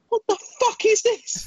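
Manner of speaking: a horrified cry of disbelief; freaked out. Retold or imitated. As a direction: squeal it in horrified panic. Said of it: "I was literally freaking out".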